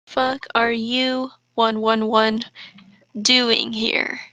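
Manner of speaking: ordinary talk, nothing marked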